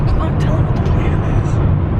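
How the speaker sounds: voiceless whisper, lost voice; raspy whisper. Real, not acted. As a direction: push words out with no voice. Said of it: "I have to whisper everything".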